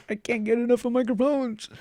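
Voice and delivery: high-pitched, put-on gushing at modest volume; mock gushing. Microphone lover admitting he can't get enough, mock gushing. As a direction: play up a microphone obsession in a high voice.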